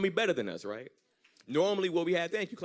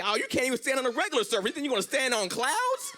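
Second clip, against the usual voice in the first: incredulous mockery; 11 semitones higher, 3 dB louder than usual. Mocks women in heels on clouds.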